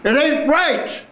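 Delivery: very high, slow, forceful cry; everything you've got. Acted. Actor told to give it everything, strong high cry. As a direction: give it all, high and drawn out. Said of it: "give it everything you got".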